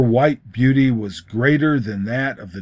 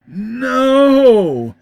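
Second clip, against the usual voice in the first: moaning no; 12 semitones higher, 6 dB louder than usual. A narrator's reading. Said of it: "almost with a moan".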